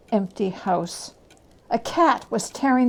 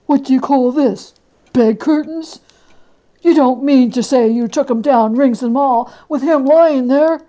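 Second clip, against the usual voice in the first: louder, higher gruff questioning; a shocked but greedy fence; loud disbelieving old dealer; old Joe, incredulous; 3 semitones higher, 9 dB louder than usual. Acted.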